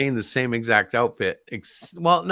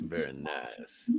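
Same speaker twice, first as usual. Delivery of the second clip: much lower, softer and slow; mock low voice; 14 semitones lower, 15 dB quieter, much slower than usual. Repeating praise of the cover as a slow, low mock echo.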